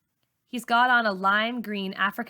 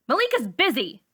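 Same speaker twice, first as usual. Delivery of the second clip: short, higher sharp retort; snapping; 6 semitones higher, 4 dB louder than usual. A narrator's reading. Char snapping at Caleb to keep Malika away. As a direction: cut him off hard. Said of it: "Char snaps".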